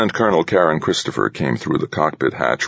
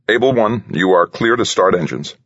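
higher-pitched, clipped radio clearance; clipped controller voice, pitched up; clipped flight controller; 7 semitones higher than usual. A narrator's reading. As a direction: give clearance like a crisp air traffic controller. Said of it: "said the flight controller's clipped voice".

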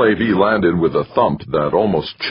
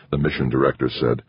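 usual speaking voice

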